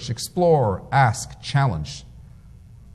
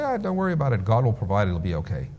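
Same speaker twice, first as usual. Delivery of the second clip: quieter, softer easy reassurance; relaxed, softer voice waving off worry; soft, unbothered; 4 semitones lower, 6 dB quieter, faster than usual. Retold or imitated. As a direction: brush it off gently. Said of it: "he would say".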